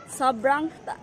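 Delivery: ordinary talk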